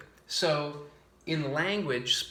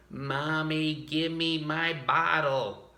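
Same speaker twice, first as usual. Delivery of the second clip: put-on child asking; 2 semitones higher, much slower than usual. Voicing a baby asking.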